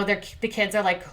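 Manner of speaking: normal talking voice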